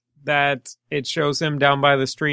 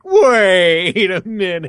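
slow imitation of a doubter; mock doubt; 3 semitones higher, 3 dB louder, slower than usual. Host teasing co-host for contesting the story, mock doubt. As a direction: draw it out, mimicking a sceptical friend. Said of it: "he has to contest this".

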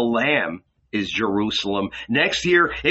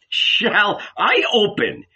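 mocking; 8 semitones higher, slower than usual. A narrator's reading. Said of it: "smiled mockingly".

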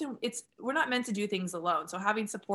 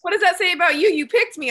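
loud excited tease; 9 semitones higher, 11 dB louder than usual. Real, not acted. Host laughs, teasing loudly.

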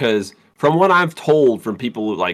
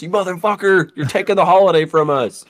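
raised, angry cop voice; 7 semitones higher, 3 dB louder than usual. Pretend. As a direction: accuse them, half shouting. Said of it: "these two officers".

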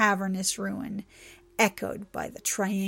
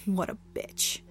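whispered aside; 4 dB quieter than usual. A narrator's reading. Trixie's inner thought.

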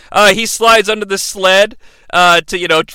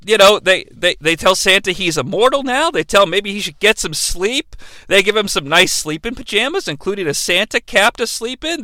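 ordinary talk, nothing marked

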